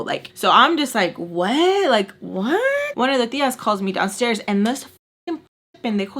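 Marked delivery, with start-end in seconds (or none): 1.2-3.0 high, rising disbelief; incredulous what. Retold or imitated. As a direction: react in offended disbelief.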